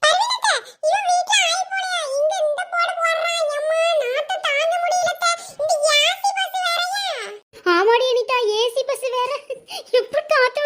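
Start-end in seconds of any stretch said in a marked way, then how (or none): 0.0-7.0 put-on high, slow drawn-out child complaint; whiny child. (நாற்றம் தாங்காமல் சிணுங்கும் சிறுமி)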